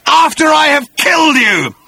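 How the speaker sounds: high, loud death threat; threatening shout. Acted. Agrajag promising to kill Arthur, high threatening shout. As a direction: shout it as a furious villain.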